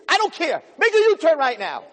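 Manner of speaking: shrieking wife. Pretend. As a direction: yell it at the husband driving. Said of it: "Your wife's in the car".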